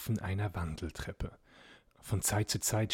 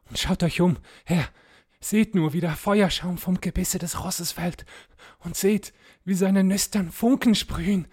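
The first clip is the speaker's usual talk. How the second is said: squire warning his master, fear building to loud, high alarm; frightened squire, rising panic; 9 semitones higher, 8 dB louder than usual. A narrator's reading. Squire warns his master of the rider behind, growing panic. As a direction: start hushed and scared, then climb higher and louder in panic. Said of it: "flüsterte ängstlich".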